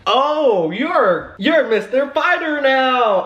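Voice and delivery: high, loud, drawn-out exclamation; mock outburst. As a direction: wail it out in exaggerated indignation. Acted outburst at retold crush question.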